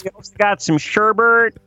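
playful Wisconsin neighbor inviting everyone over; Wisconsin accent. Retold or imitated. Host invites the neighbors to a backyard party, light Wisconsin accent. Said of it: "coming from a Wisconsin accent".

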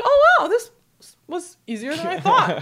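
high-pitched surprise with a laugh; pleasantly shocked. Retold or imitated. Daughter after mom hung up quickly, retold with laughing surprise. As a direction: replay your own amused relief. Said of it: "I was kinda shocked".

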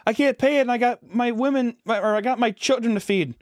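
high-pitched mock woman's plea; pleading woman. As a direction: put on a high woman's voice, making excuses. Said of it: "some woman's like".

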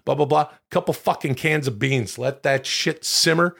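usual speaking voice

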